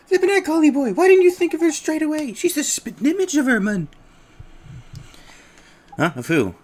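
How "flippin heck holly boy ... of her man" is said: higher, loud, excitable little sidekick; high cheeky fairy voice. Acted.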